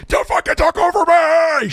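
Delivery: imitating a shrieking, high, loud scream at him; mock her screaming over the phone, way up high; death metal scream. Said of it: "sounded like she was in a death metal band".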